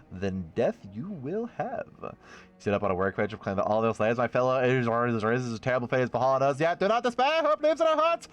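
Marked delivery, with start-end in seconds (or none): 0.1-1.9 a hint of a cold tyrant; lightly voiced sinister master; menacing villain. Villain reply in read story. 4.2-8.3 building from measured to higher rallying cry; a hint of a rebel leader stirring the slaves; mild oratorical build to a hopeful high; rousing speech. Rebel speech read aloud.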